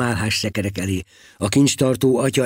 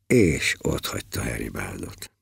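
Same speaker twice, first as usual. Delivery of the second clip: ordinary delivery, nothing marked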